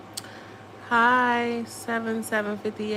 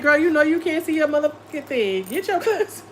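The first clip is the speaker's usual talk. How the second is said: higher, laughing mock scold; imitating her mom; 5 semitones higher than usual. Telling chat how her mom nagged about glasses, laughing. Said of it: "She like".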